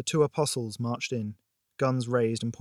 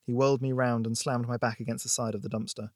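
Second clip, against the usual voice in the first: ordinary delivery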